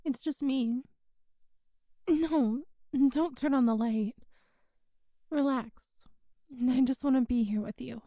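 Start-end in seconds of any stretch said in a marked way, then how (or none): none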